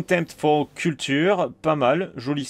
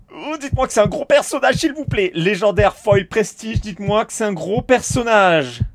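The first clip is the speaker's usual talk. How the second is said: high, fast, louder begging; excited pleading; 6 semitones higher, 5 dB louder, faster than usual. Real, not acted. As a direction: plead with the cards, thrilled. Begging for a big character. (supplique excitée, aiguë et rapide)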